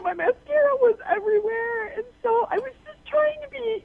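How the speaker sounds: high-pitched tearful recounting; crying. Real, not acted. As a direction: voice rising as the tears come. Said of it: "you crying about the situation".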